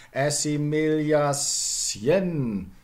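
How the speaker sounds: ASSIMILATION ending "a-syen", very slow; intermediate older form. Shown as a demonstration. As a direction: stretch assimilation with s plus y and weaker "en". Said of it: "That's the way it went".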